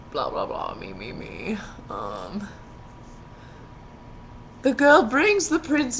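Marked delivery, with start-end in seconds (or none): 0.1-2.5 mock dismissive mumble. A narrator's reading. Skipping text in mock voice.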